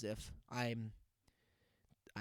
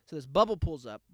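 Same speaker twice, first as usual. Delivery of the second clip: ordinary delivery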